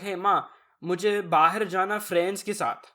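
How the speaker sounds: Urdu-English mix in a deliberate Urdu accent. Shown as a demonstration. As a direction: say FRIENDS with a Desi accent inside the Urdu. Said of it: "You gotta do it with the accent".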